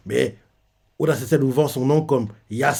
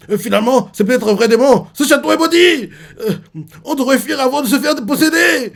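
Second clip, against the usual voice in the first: much higher and louder, frightened babble; panicky dog; 10 semitones higher, 5 dB louder than usual. Retold or imitated. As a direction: babble in mock fear of the cursed castle. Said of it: "Rhodes commence à paniquer".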